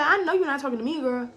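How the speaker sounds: high, affronted retort; sassy retold challenge; indignant comeback. Acted indignant comeback to insult.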